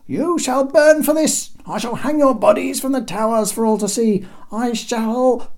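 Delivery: furious emperor. Acted. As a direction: rage like a petty tyrant. Emperor threatens in fury.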